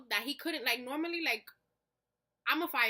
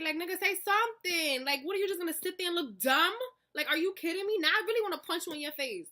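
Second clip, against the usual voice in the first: higher, louder exasperated rant; retold anger; 6 semitones higher, 4 dB louder than usual. Girlfriend confronting her silent boyfriend, exasperated retold anger. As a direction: lay into him, fed up. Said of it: "I was mad".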